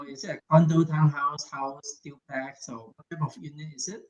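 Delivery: muffled voice fading out; muffled, fading mic sound. Real, not acted. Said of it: "It's muffled, your voice".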